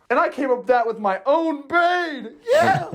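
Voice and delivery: loud, high, proud exclamation; excited shout. Real, not acted. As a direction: shout with pride at your own idea. Bragging about own idea.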